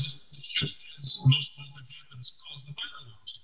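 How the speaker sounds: breaking up on call; fading audio dropout; cutting out. Real, not acted. Audio dropping out.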